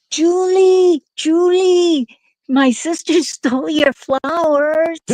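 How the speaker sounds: put-on high, drawn-out tattletale call of little kids; sing-song kid tattling. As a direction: call out like kids ratting on a sister.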